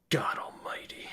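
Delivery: put-on slow, hushed German-accented whisper; shocked whisper. Reading the doctor's shocked reply, a slow whisper. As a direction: breathe it out in dismay. Shocked reaction to bad news.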